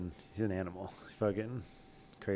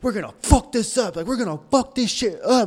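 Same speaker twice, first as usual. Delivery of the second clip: much higher, louder hype-man rallying cry; intense hype; 11 semitones higher, 6 dB louder than usual. Retold or imitated. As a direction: fire up the crew before the concert. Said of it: "all intense".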